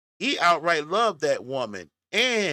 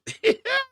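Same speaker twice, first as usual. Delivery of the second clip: burst of laughter. Real, not acted. Laughing at the boy's comment.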